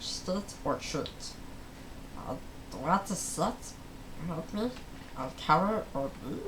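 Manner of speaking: ordinary delivery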